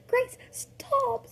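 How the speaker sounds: high voiced stop call; high pleading call. Finny telling the girl to stop, in a high voice. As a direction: call her off the fight. Character calling to stop fight.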